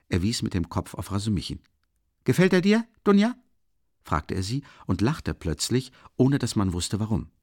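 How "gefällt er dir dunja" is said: high, loud, teasing question; spring the question on her eagerly; sudden liveliness. A narrator's reading. Brother teasing his sister about a friend, high and lively. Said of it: "mit einer an ihm nicht gewohnten Lebhaftigkeit".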